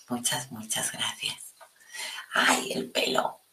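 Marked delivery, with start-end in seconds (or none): 0.1-1.5 soft grateful whisper; whispering thanks to the viewer Mario. Real, not acted.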